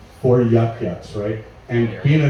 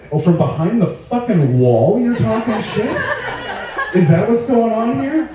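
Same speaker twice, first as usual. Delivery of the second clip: mock indignation; 9 semitones higher than usual. Real, not acted. Heckler cut-in.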